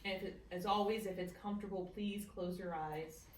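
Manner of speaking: calm guided meditation voice. Acted. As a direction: ease them gently into the practice. Leads a meditation.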